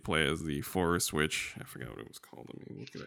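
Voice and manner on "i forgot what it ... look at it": whispered to himself while looking; muttered aside. Real, not acted. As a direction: mutter it under your breath. Aside while searching for title.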